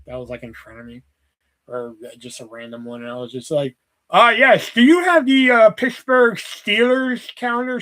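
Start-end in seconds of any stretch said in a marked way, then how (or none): 4.1-7.8 high, loud, put-on voice with a slow finish; prank caller voice. Bored clerk prank-calls the kiosk, high put-on voice. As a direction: draw out the request in a silly high voice.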